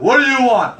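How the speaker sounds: mock tough guy demanding what you want; big ego guy. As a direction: bark it like a big-ego customer. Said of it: "if it's a big fucking guy, big ego".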